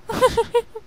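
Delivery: high, soft little giggle. Acted. Rarity pocketing three gems, a high soft giggle. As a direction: giggle to yourself guiltily. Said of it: "She giggled".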